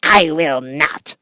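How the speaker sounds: a lightly voiced witch, slow and curt; a hint of a witch refusing; witch retorting. A narrator's reading. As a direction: snap back like a stubborn old witch. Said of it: "retorted the witch".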